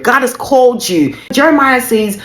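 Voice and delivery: ordinary talk, nothing marked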